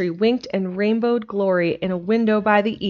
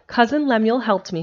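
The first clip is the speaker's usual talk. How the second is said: ordinary delivery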